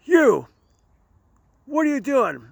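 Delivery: high, barked cop challenge; mock cop yelling at a drunk; disgusted cop. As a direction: play the startled officer shouting. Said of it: "the officer goes".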